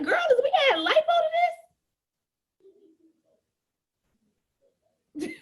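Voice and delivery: high, quick playful jab; sassy girlfriend tease. Retold or imitated. Seller to weight-loss client, high sassy teasing. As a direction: tease her like a close girlfriend. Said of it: "but this is my personality".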